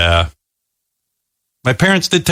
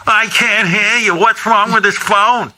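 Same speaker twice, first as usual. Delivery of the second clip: mom voice; 10 semitones higher, slower than usual. Retold or imitated. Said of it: "she goes".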